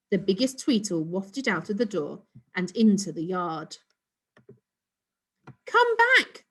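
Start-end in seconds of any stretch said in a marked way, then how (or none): 5.7-6.4 high, loud desperate yell. A narrator's reading. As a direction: yell after the rocket. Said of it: "Ricky yelled".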